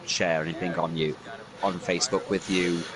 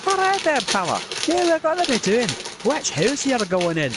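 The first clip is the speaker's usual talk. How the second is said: chummy Scottish voice; 13 semitones higher than usual. Acted. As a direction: hail the Sorting Hat like a pub mate. Drunk Scot greeting Sorting Hat.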